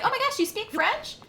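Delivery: high, gushing mock amazement; imitating amazed people. Mocking people who learn she speaks French, amazed. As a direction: play the starstruck stranger. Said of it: "they're like".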